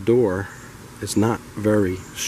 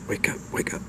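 hushed wake-up whisper; urgent whisper; 7 dB quieter, faster than usual. Acted. Young bride waking her husband, urgent whisper. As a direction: whisper to rouse someone sleeping.

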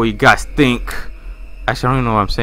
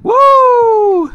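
mock whoop; 6 dB louder than usual. Real, not acted.

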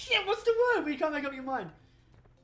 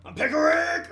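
excited catchphrase; 8 semitones higher, 8 dB louder, slower than usual. Pretend. Quoting the cartoon catchphrase.

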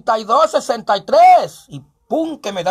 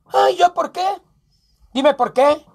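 high startled protest; mock outrage, pitched up; indignant whine; 5 semitones higher than usual. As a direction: squeak out the objection. Playing accused man protesting.

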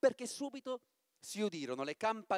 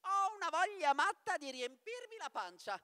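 much higher, merry bird-catcher voice; cheerful exclamation; 10 semitones higher than usual. Acted. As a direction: blurt it out gleefully like a hungry clown. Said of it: "esclamò allegramente". (Papageno allegro, voce molto acuta)

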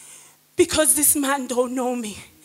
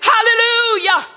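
loud, higher, ringing sermon voice; full-force preaching; 5 semitones higher, 4 dB louder than usual. Real, not acted. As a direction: preach it out to the whole church. Preaching testimony to the congregation.

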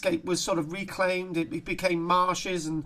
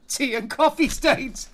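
slow, higher, breaking into a laugh; amused; 4 semitones higher, slower than usual. Real, not acted. Lecturer tickled by the coffee stains, amused. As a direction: stretch it out into laughter. Amused by article's last line.

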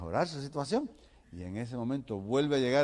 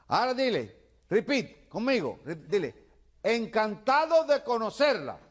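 high, slow shouted drilling; shouting; 12 semitones higher, 5 dB louder, slower than usual. Acted. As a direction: keep hollering the phrase for him to repeat. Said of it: "le sigue gritando".

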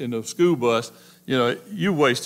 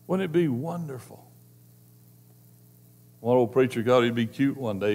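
ordinary delivery

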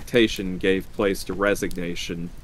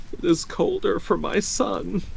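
put-on high, grieving old woman; weeping; 11 semitones higher than usual. A narrator's reading. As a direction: mourn a lost son in a high voice. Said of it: "and wept afresh".

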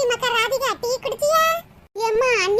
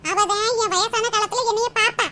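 usual speaking voice

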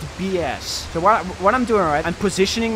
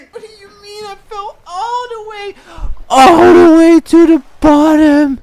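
high, loud, cracking wail of disbelief; anguished outcry; 12 semitones higher, 13 dB louder than usual. Real, not acted. Gamer wailing after falling to the bottom, near tears. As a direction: cry out in despair at losing everything. Fell all the way after climbing.

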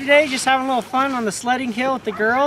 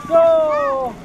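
shouted go; 7 semitones higher, 6 dB louder than usual. Real, not acted. Calling the race start.

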